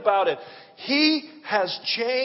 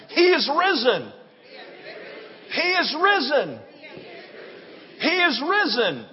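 proclamation; 5 semitones higher than usual. Real, not acted. Preacher proclaiming Easter cry.

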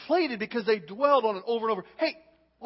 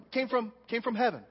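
usual speaking voice

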